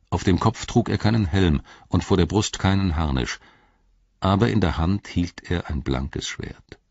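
ordinary delivery

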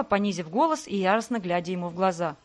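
plain reading voice

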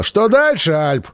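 high shouted question; calling out. Acted. Мира кричит Альпу, чего он хочет дальше. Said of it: "прокричала".

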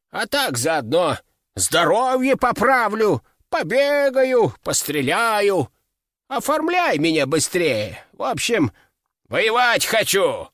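put-on slow, high, creaky old codger; feisty old man. A narrator's reading. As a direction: push hard like a stubborn old man. Old duke insists on serving.

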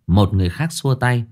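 ordinary delivery, nothing marked

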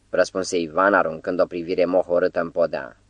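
plain reading voice

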